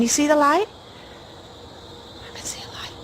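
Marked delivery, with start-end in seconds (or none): 2.3-2.9 tense whisper in the dark; scared hushed whisper. Real, not acted. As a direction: breathe it so nothing hears you. Alone in dark woods, ghost hunting.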